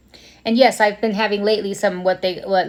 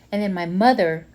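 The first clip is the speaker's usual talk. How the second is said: ordinary talk, nothing marked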